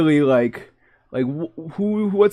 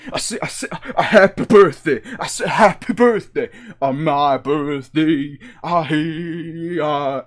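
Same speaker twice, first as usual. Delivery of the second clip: Reverend Pastor; 3 semitones higher than usual. Acted. Streamer raps happy birthday, Reverend Pastor voice. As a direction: rap it like an exclaiming reverend. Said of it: "the Reverend Pastor voice".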